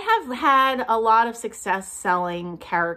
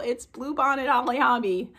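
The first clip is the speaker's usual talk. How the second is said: ordinary talk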